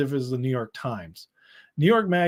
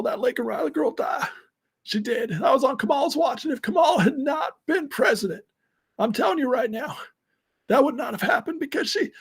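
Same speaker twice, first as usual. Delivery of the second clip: a raspy, hammy ex-president pleading; Bill Clinton impression; 10 semitones higher than usual. Viewer asked for it, so he hams up a Bill Clinton plea. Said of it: "I do a Bill Clinton impression".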